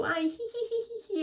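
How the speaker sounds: put-on high, soft, drawn-out girlish tittering; mock romantic giggle. Showing the romantic manner she avoids, mock giggly. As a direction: giggle like someone hopelessly smitten, hammed-up. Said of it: "eu tento não ser romântica".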